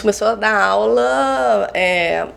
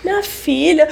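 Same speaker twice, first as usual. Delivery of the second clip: imitando o pai entre riso e choro; laughing and crying; 6 semitones higher than usual. Retold or imitated. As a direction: risada emocionada do pai. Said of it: "ele ria e chorava ao mesmo tempo". (pai rindo e chorando com a filha)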